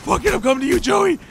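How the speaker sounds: high, loud frantic yelling; panicked yelling. Real, not acted. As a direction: shout it while fleeing gunfire. Panicked run under fire.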